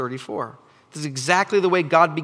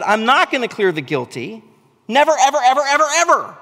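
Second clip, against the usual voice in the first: loud, much higher, insistent never ever; emphatic divine refusal; 8 semitones higher, 8 dB louder than usual. Acted. Preacher voicing God refusing to clear the guilty, shouting. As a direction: hammer each never harder. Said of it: "God says".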